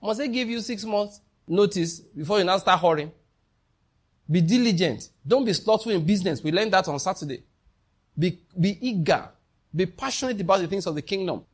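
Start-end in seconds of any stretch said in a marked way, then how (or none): none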